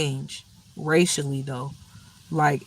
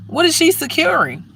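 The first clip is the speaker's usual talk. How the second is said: pitched high in disbelief, she stressed; incredulous; 10 semitones higher than usual. Real, not acted. Ranting about a pregnant guard, incredulous high. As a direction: ask it again, way up in disbelief.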